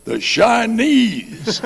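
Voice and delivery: very high, loud, slowly drawn-out "Chinese"; proud wrong answer. Retold or imitated. Bobby answers the lieutenant with the wrong word, slow high loud declaration. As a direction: belt out "The Chinese" slowly and confidently.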